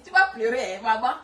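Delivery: ordinary talk, nothing marked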